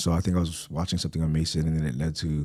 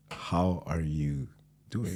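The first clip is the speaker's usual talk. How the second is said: slow sincere how are you; 7 dB quieter, much slower than usual. Retold or imitated. To a friend at work, a slow, quiet heartfelt how are you. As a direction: ask it slowly like you truly care. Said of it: "you're like".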